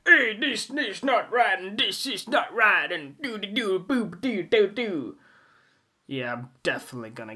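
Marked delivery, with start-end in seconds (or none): 0.1-5.2 higher, louder whiny protest voice; mock complainer. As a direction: parody someone objecting to everything. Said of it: "Don't be like".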